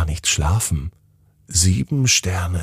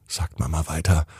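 ordinary delivery, nothing marked

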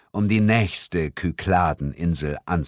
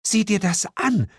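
high-pitched amazed remark; astonished, lightly voiced woman; stunned murmur. A narrator's reading. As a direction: marvel at the sight. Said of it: "murmelte sie verblüfft".

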